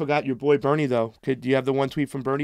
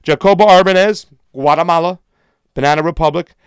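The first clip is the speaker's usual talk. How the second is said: loud sharp voice; yelling; 9 dB louder than usual. Real, not acted. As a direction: shout out each name and country. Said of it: "sorry for yelling so loud".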